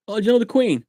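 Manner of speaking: high, eager mock question; imitated naive American. American quizzing a Brit, naively eager. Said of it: "most Americans think".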